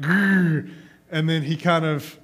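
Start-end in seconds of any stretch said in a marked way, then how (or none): 0.0-0.7 playful little growl, pushed louder; toddler growl. Retold or imitated. Dad imitating his son's growl from the toy box, playful. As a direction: growl like a toddler pretending to be a monster. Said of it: "and he went".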